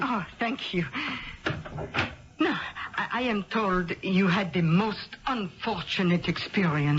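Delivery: put-on French accent, a bit overdone; phony French accent. Posing as a perfume rep, phony French accent. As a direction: thank him in a fake French accent. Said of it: "It was a phony French accent".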